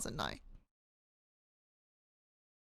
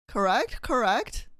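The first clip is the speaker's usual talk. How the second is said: ordinary talk, nothing marked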